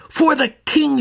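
very high, loud war cry; battle scream. Acted. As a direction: scream it as you strike. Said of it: "she screamed".

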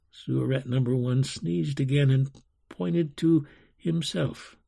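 ordinary delivery, nothing marked

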